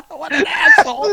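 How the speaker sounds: high laughing shout; teasing friend, real laugh. Real, not acted. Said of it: "laughing at the story".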